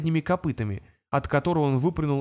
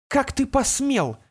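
high, slow angry shout; 9 semitones higher, slower than usual. A narrator's reading. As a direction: yell it at the goblin. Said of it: "крикнула Кобылина".

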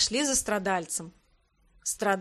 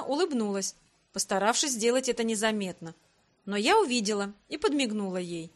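plain reading voice

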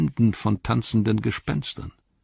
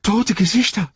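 höher und lauter, voller Grauen; entsetzter Ausruf; 5 dB louder than usual. A narrator's reading. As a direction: schrei es entsetzt heraus. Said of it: "rief er entsetzt". (Sam ruft entsetzt, als er die Toten sieht)

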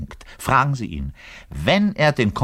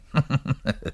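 sly giggle; 5 semitones higher, 5 dB quieter than usual. Acted. Giggle after secret confession.

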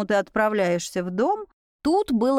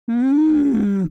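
loud rolling growl; beast growl; 5 dB louder than usual. A narrator's reading. Werewolf out of patience with the humans, growling. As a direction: growl it through a closed mouth. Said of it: "прорычал он".

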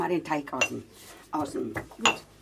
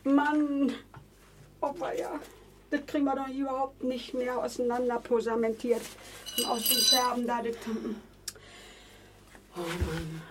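high, wailing dismay over the broken jar; distressed lament; 11 semitones higher than usual. Acted. Old woman wails over the spilled medicine, high-pitched lament. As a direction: moan over the mess, near tears.

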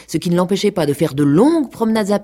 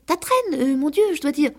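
much higher, faltering cat; hesitant cat; 12 semitones higher than usual. A narrator's reading. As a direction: stall for words as the cat.

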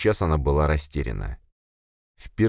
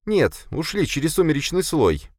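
much higher female-character voice, kept calm; deliberately calm; 12 semitones higher than usual. A narrator's reading. Sofia reporting failure to her boss, calm. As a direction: answer as calmly as you can, pitched high. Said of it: "как можно спокойнее ответила Софья".

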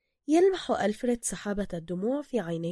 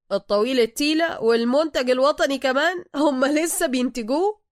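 mocking disbelief; 5 semitones higher, 8 dB louder than usual. Officer mocks the claim.

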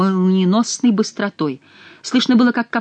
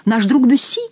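joyful; 6 semitones higher, 4 dB louder than usual. A narrator's reading. Joyful recognition of friend.

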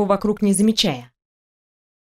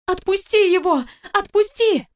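much higher, urgent narrated cry; screaming plea; 10 semitones higher than usual. A narrator's reading. Wife screaming in her husband's ear to let go, desperate cry. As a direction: cry out high without extra volume. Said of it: "крича в ухо".

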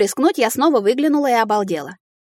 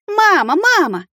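put-on high, loud child's cry for mama; high-pitched wail, loud and eager; wailing call; 11 semitones higher, 4 dB louder, slower than usual. A narrator's reading. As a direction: call out to mama like an excited baby dragon. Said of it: "заголосил Кирюша".

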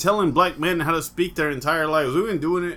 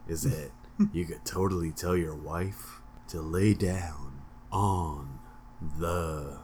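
much lower, slow, soft voice; low bass voice; 12 semitones lower, 5 dB quieter, slower than usual. Shown as a demonstration. Host jokes about his low bass voice for listeners. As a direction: sink into a deep bass rumble. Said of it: "Lowered bass".

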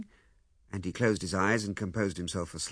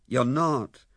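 loud, quick contradiction; emphatic denial; 3 semitones higher, 7 dB louder than usual. Acted. Lewisham refuses to let Ethel blame herself, emphatic. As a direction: cut in firmly and louder.

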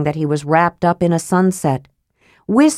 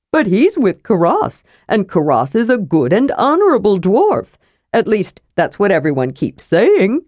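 chatty inquisitive kender; 3 semitones higher than usual. Acted. Narrator voices Tas the kender.

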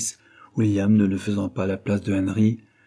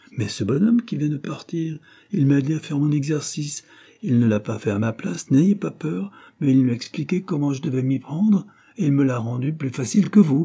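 put-on higher-pitched, lively boy reporting news; eager schoolboy; 6 semitones higher than usual. A narrator's reading. Henry telling his father who helped him, eager. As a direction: tell your father all about it, eagerly.

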